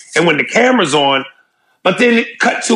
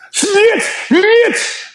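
much higher mocking cry of snitch; mock shrill accusation; 14 semitones higher than usual. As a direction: jeer it like a playground taunt. Mock calling out.

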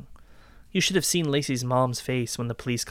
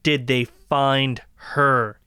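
slow, each word pressed, louder; insistent demand; 4 dB louder, much slower than usual. Acted. Man demanding she answer about her sister, insistent. Repeating the question harder.

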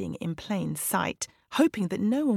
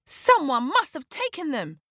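high, indignant disbelief; shocked storybook outcry; 7 semitones higher, 4 dB louder than usual. A narrator's reading. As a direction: exclaim it high, as if you can't believe it. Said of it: "They all stared in disbelief".

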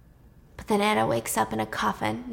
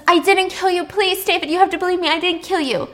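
desperate pleading; 9 semitones higher, 4 dB louder than usual. Retold or imitated. Anna pleads as she's dragged.

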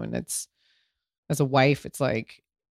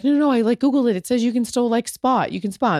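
anxious reassurance; 7 semitones higher, faster than usual. Retold or imitated. Husband insists spotting is normal, high and urgent. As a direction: talk fast over the worry like a panicked husband. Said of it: "Davide was like".

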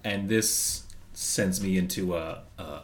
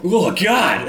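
high, loud "ugh" of revulsion; disgusted outcry; 10 semitones higher, 9 dB louder than usual. Acted. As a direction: recoil from an awful taste, loudly. Acting disgust at the drink.

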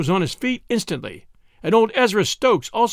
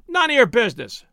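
lightly voiced curt snap, higher and louder; curt cook reply; 9 semitones higher, 5 dB louder, faster than usual. A narrator's reading. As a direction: cut him off sharply. Cook snaps back.

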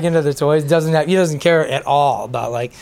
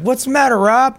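high, louder imitation of a joker; mock teasing; 10 semitones higher, 4 dB louder than usual. Musician retelling Harrison Ford ribbing his brother, mock teasing. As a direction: needle him playfully, pitched up.

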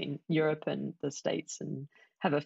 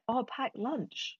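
dawning realization; 8 semitones higher, slower than usual. Retold or imitated. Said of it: "And I was like".

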